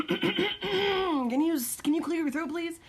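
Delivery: high, hammed-up polite ask; mock polite request. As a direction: put on a sweet nagging voice. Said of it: "you're like".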